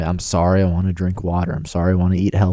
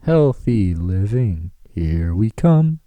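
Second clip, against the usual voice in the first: stretched-out slow mock announcement; slow mock hype; much slower than usual. Repeating his catchphrase to the guys, slow and hammed-up. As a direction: draw it out like a slogan.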